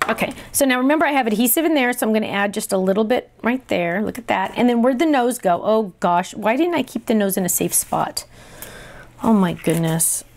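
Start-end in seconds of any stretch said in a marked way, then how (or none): none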